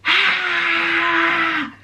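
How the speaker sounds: high, sudden scream of joy; a squeal of disbelief at winning; excited scream. Retold or imitated. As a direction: shriek like you just won the prize. Hearing her name drawn live.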